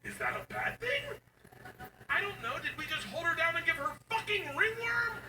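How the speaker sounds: very high frantic shriek; panicked sarcasm. Retold or imitated. Dad panicking that mom will hear, frantic. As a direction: freak out sarcastically.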